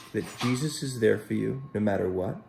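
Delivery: ordinary delivery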